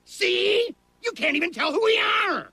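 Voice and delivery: high, loud exasperated yell; exasperated shout. Acted. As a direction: yell it in frustration. Exasperated outburst at family.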